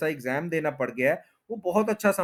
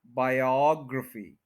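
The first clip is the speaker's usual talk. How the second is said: Biography as slow bai-AWG-ruh-fee; second-syllable stress; slower than usual. Shown as a demonstration. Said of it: "second पे stress है".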